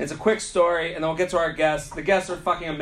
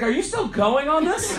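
high-pitched retold disbelief; incredulous challenge; 10 semitones higher than usual. Comic retelling his reply to a rude woman, high incredulous challenge. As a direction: act out being amazed she won't drop it.

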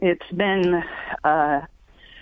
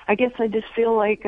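ordinary talk, nothing marked

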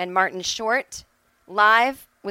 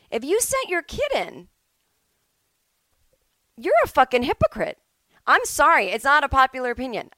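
heated rant; 5 semitones higher, 4 dB louder, faster than usual. Real, not acted.

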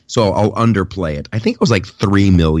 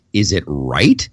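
ordinary talk, nothing marked

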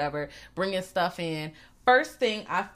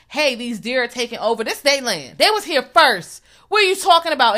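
rising to a higher, louder mock rant; 5 semitones higher, 6 dB louder than usual. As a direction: build into an indignant hammed-up rant.

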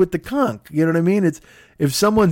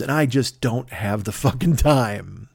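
normal talking voice

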